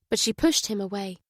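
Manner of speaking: ordinary delivery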